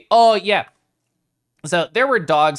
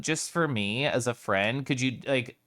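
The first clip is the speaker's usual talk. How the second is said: usual speaking voice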